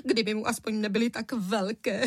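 tearful acted lament; weepy complaint with a sob at the end; sobbing. A narrator's reading. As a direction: whimper it out, breaking into sobs. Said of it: "vzlikala paní Středníková".

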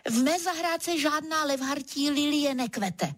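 high, sustained, mildly fierce reply; fierce retort. A narrator's reading. Gardener denying the leopard his lily, mildly fierce retort. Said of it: "odseknu a tvářím se sveřepě".

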